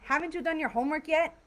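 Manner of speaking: high, fast nagging from a fed-up parent; frustrated parent. Shown as a demonstration. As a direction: scold a kid who still hasn't done it. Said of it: "that's even stronger".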